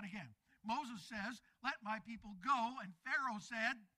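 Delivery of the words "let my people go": loud declaration; commanding. Acted. Preacher as Moses demanding Pharaoh free the people, commanding. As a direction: proclaim it as a demand.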